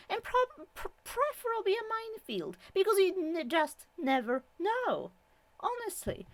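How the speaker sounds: high, pleading, over-the-top rant; exasperated rant. Real, not acted. Vlogger pleading with viewers to lock doors, high exasperated rant. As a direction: beg the viewers, then spiral into mock paranoia. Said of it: "Sorry, rant".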